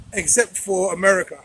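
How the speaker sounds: ordinary delivery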